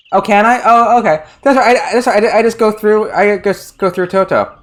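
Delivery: high, loud sudden delight; bursting with discovery; excited realization. Real, not acted. The player realizes they can pass through Toto, excited.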